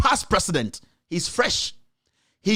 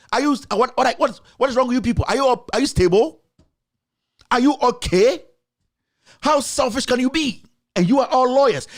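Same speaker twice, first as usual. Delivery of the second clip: raised, heated demands, pitch climbing; angry outburst; 6 semitones higher than usual. Real, not acted. Host scolding the legal council on air, heated and high. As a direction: berate them like a fed-up host.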